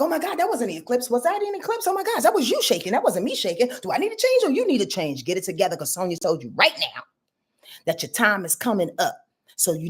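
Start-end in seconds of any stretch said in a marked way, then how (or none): 0.0-5.2 high, fast, flustered denial; mocking flustered Virgo. Astrologer mocking a Virgo in denial, high fast fluster. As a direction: squeal it like a panicked Virgo in denial. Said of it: "It's like".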